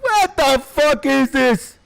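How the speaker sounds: very high, drawn-out wail of disbelief; exasperated outburst. Real, not acted. Gamer stuck hunting for a character, in a high, slow, exasperated cry. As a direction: stretch each word in a high, frustrated cry. Frustrated search for game character.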